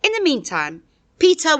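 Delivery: ordinary delivery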